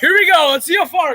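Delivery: high excited buildup. Real, not acted. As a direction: hype up the kick. About to kick shoe.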